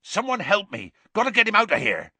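urgent call for help. A narrator's reading. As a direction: shout for help in an emergency. Hagrid urgently calling for help.

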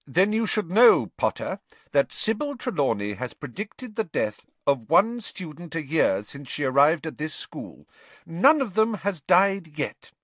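high, emphatic, dry teacher voice; crisp professor, firmly dismissive; stern schoolmistress. Acted. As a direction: debunk the prophecy with brisk authority.

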